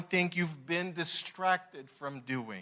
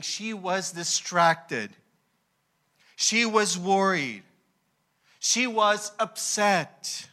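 slow emphatic list; slower than usual. Real, not acted. Listing Martha's feelings slowly.

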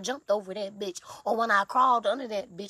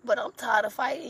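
ordinary talk, nothing marked

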